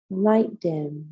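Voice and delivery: unhurried, calm yoga teacher leading relaxation; guided meditation. Real, not acted. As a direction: guide them gently into the breath, phrase by phrase. Leads a guided breathing meditation.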